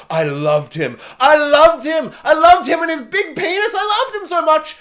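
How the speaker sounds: mock Dumbledore pouring his heart out; mock gushing Dumbledore. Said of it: "he's like".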